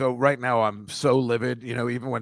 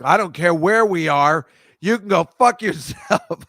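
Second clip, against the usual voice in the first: slow, loud pretend telling-off, breaking into a laugh; hammed-up rage that cracks into laughter; mock furious; 6 semitones higher, 6 dB louder, slower than usual. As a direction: spit it out like you'd really lose it at the waiter. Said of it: "I'm like".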